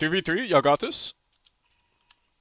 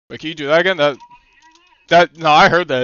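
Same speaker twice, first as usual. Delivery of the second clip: ordinary talk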